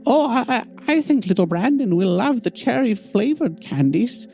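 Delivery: put-on high, fussy old woman; old lady voice. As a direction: gush over candies like a granny. Old woman chatting about candy.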